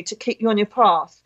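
ordinary talk, nothing marked

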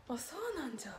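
soft whisper in Hiroshima dialect. Real, not acted. Streamer to Hiroshima listener, whispered. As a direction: breathe it like a private joke.

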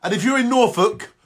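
normal talking voice

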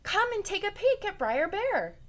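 high, loud call to the others. Acted. Rabbit calling the animals to see the tied bear, gleeful. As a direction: call everyone over, gleeful. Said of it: "called out to the others".

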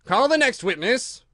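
high, loud royal summons; a king's ringing command; calling out. Acted. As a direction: call it across the courtroom. King calls out in court.

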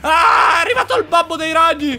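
excited shout. Real, not acted. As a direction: shout at the giant spider father. Huge spider boss arrives. (urlo eccitato, più alto e più forte)

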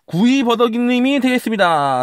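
high, loud, drawn-out congratulations; booming prize announcer. Real, not acted. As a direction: announce the winner like a game show. Big winner announcement.

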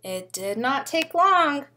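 plain reading voice

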